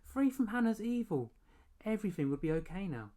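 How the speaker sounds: high, loud elated; celebrate, bursting with relief; rejoicing. A narrator's reading. Boy celebrates being free of Hannah, high and elated.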